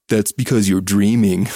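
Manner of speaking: mocking sarcastic retort, drawn from a quote. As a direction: imitate a scruffy guy's sarcastic jab. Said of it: "sarcastically says".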